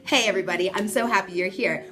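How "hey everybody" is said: ordinary delivery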